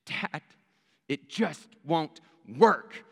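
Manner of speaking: slow, close-to-tears mock plea; a touch of a crying girl, drawn out; tearful daughter. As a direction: voice the child fighting back tears, understated. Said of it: "tears are starting to fill her eyes".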